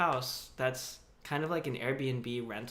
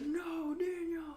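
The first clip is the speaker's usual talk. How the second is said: mock anguished cry; slower than usual. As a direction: wail it like a heartbroken commenter. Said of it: "Everybody's like".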